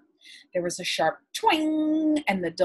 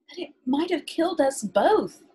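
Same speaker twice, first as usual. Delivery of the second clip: alarmed protest; 9 semitones higher than usual. Acted. Matt protests the bear killing.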